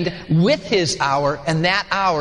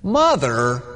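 tender "Mother"; 5 semitones lower than usual. Shown as a demonstration. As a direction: call her "Mother" with tenderness. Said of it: "Let me use voice intonation".

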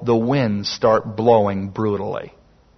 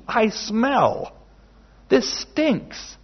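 mock confession; 8 semitones higher, 4 dB quieter, much slower than usual. Confessing prayer acted out.

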